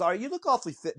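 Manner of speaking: retelling the coach in a voice pitched well up; high coach voice. Retold or imitated. Coach eyeing a big recruit, voiced high. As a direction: play the coach up high, sizing the kid up. Retold college coach sizing up player.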